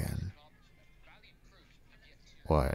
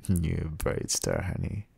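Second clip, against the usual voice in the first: ordinary talk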